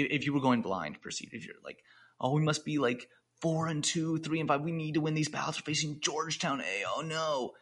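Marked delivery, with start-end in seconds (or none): 2.2-7.5 lightly acted panicking student, higher; mock panic. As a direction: imitate a team freaking out, a touch rushed. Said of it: "they're all freaking out".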